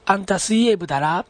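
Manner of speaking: あんた水泳部だら asked, with だら rising at the end; questioning rise. Shown as a demonstration. Radio host giving an example of Mikawa dialect, だら rising as a question. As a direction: lift the final だら into a question. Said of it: "語尾を上げて疑問形で用いる".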